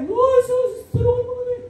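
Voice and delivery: high, wailing plea for help; pleading cry. Retold or imitated. Retelling the people's cry to God for help, high and wailing. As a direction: cry out to the Lord for rescue.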